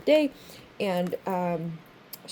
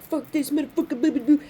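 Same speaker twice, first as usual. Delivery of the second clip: higher, mock-angry grumbling, tossed off; mock angry rant; 6 semitones higher, 3 dB louder than usual. A woman fed up with men, mock angry rant. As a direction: play an angry rant for laughs. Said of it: "if you've been angry".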